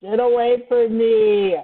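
high, drawn-out shout; panicked yell. Real, not acted. Player fending off an attacker in a game, panicked yell. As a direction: yell it slowly to drive someone off.

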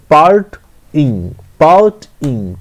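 Part ইং slowly split into two beats; syllable by syllable. Shown as a demonstration. Teacher showing two syllables, part ইং broken apart. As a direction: pause between part and ইং. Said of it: "তো এখানে দুটো syllable রয়েছে".

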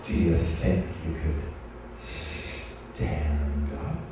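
low, taunting serpent voice, far below her own; provocative snake voice. Retold or imitated. Anaconda taunting the speaker during the ceremony, low and provocative. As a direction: tease her like a sly giant anaconda. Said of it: "and then he says".

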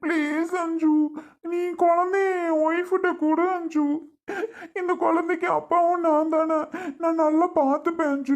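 high, crying plea on his knees; tearful begging. Acted. On his knees begging Anju for the baby, crying. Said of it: "கால்ல விழுந்து கெஞ்சி கேக்குறேன்".

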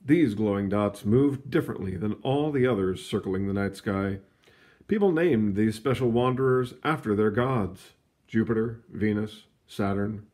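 lively read-aloud storybook voice; story narration. A narrator's reading. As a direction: read it like a picture book to kids.

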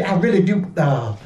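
ordinary delivery

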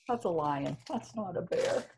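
ordinary delivery, nothing marked

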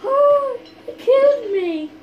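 high, loud wail of defeat; dismayed cry. Real, not acted. Gamer reacting to being killed, dismayed cry. Just died in the game.

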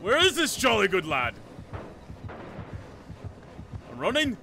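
indignant mock-posh accent, calling out; distinguished gentleman accent. Bidding the killer good day, indignant gentleman accent. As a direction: declaim like an affronted gentleman in accent. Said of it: "speak like distinguished gentlemen".

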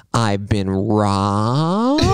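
very slow, drawn out melodrama; mock dramatic wail. Quoting her voicemail to a stranger, drawn-out mock wail. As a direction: stretch every syllable like a soap-opera victim.

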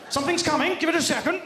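high, strained voice, as if injected with a serum; middle-class awkward romance. Acted. Middle-class person about to be romantic, high and strained. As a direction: squeeze it out in high, awkward anticipation. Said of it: "middle-class person's about to be romantic".

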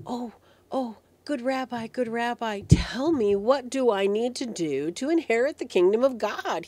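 breathlessly keen storytelling voice; excited young man. Acted. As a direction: act an excited youth rushing up with a question. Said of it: "He's all excited".

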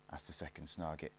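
ordinary delivery, nothing marked

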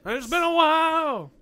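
dramatic echo. Pretend. Echoes friend's line dramatically.

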